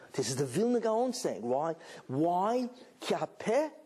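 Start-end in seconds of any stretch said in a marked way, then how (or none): none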